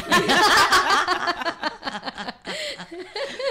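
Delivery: loud, high, rising laugh; high burst of laughter. Real, not acted. Priests admit they were scared.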